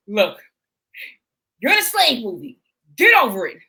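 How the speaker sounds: loud, punched, no-nonsense order; blunt dismissal. Retold or imitated. Host imitates director telling actor to move on, blunt. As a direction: lay it down firmly, in short bursts.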